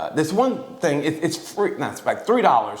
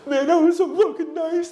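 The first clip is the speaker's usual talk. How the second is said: very high, wailing, hammed-up sob voice; mock crying. Man recalling being helped across the street, hammed-up crying. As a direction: imitate blubbering over a stranger's kindness. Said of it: "And I'm fucking crying".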